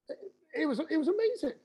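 light up remembering the good days; wistful awe at how good it was; fond enthusiasm. Real, not acted. Fond memory of pro rugby.

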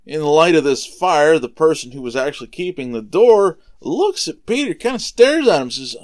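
usual speaking voice

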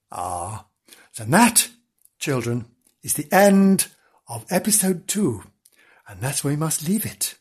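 ordinary delivery

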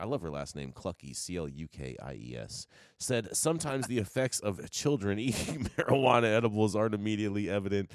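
ordinary talk

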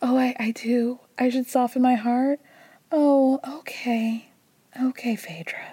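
soft, unvoiced, sugary whisper; passive-aggressive whisper. Parvati hitting back at Phaedra in a passive-aggressive whisper. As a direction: whisper it back with a sweet sneer. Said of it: "being very passive-aggressive".

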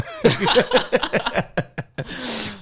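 high, wheezy run of giggles; helpless high laughing; real laughter. Real, not acted. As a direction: crack up at your own joke. Laughing at own Patreon joke.

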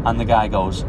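ordinary talk

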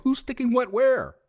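high loud baffled question; surprised. Retold or imitated. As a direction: ask it in startled disbelief. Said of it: "And I was like".

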